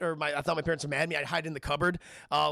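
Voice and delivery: ordinary talk, nothing marked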